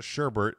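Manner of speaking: "sherbet" pronounced SHER-bert; sherbert with extra R. Shown as a demonstration. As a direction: add an R before the final T of "sherbet". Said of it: "and pronounced sherbet".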